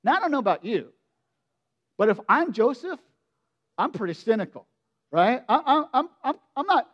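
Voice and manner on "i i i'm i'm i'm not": ordinary talk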